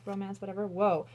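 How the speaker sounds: ordinary talk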